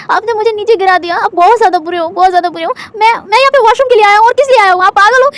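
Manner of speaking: high, whiny fake sobbing accusation; acted crying. Wing whines at Lan Wang Ji for knocking him down, fake crying. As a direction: ham up the tears to blame him. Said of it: "ये कहकर वो रो रहा था, मतलब, एकदम acting किया जा रहा था".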